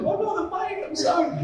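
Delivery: high, teasing mock-sorry; mock apology. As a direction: squeak out a fake sorry about the mic. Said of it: "I'm like".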